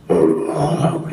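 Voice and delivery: loud growling orc moan; deep monster voice. Shown as a demonstration. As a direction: demo a big, growly creature groan. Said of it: "really deep special feel to it".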